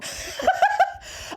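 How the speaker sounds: high, breathless burst of laughter; high giggle. Real, not acted. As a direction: crack up after your own mock rant. Laughs at own hateful paraphrase.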